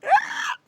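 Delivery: high-pitched exhausted wail; mock dying cry. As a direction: imitate someone collapsing after a run. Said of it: "dying. He's like, like".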